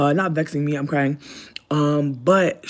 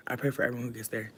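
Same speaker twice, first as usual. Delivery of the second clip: hushed fast repeat; 4 semitones lower, 8 dB quieter, faster than usual. Real, not acted.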